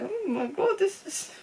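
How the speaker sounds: high-pitched imitation of welling up; mock teary. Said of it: "kind of a little teary-eyed".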